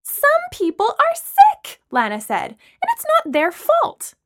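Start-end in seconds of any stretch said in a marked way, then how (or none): 0.0-1.8 high, loud, slow outrage; shrieky indignant rebuttal; outraged protest. A narrator's reading. Lana outraged in argument. 2.5-4.1 high, slow, emphatic indignation; drawn-out indignant rebuttal; outraged protest. A narrator's reading. Lana outraged in argument.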